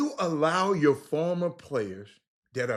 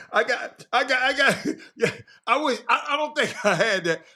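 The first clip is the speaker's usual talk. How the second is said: laughing; 7 semitones higher, 3 dB louder than usual. Real, not acted. Laughing while admitting something.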